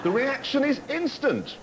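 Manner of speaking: normal talking voice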